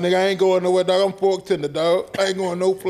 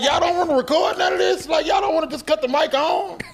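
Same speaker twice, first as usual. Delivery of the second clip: mock shout; 8 semitones higher than usual. S0 mocks being asked to record.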